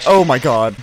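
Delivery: loud oh my God; shocked exclamation. Real, not acted. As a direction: exclaim in disbelief. Shocked at the murderer reveal.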